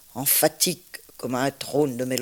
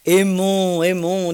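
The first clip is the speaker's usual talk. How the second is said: loud, raised recited exclamation; impassioned cry; 4 semitones higher, 8 dB louder than usual. A narrator's reading. Reciter quoting two lovers' mingled voices, impassioned cry. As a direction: call it out higher and louder, as verse.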